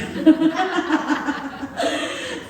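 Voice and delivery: long, amused laugh; drawn-out delighted laughter; hearty laugh. Real, not acted. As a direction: laugh heartily at your own story. Laughing at getting stroller free.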